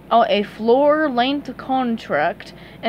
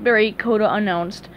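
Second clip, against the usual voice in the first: plain reading voice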